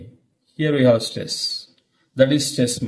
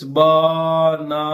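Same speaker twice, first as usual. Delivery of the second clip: BANANA drawn out slowly, all three syllables equally long and level; every syllable equal; slower than usual. Shown as a demonstration. Teacher showing learners how not to say it, BANANA with equal drawn-out syllables. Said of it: "say all the syllables equally like this".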